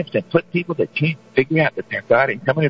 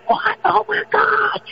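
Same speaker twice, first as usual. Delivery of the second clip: high shouted "Oh, my God"; hammed-up shocked shout; mock shock; much slower than usual. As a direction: shriek it in fake horror. Mock shocked reaction to news.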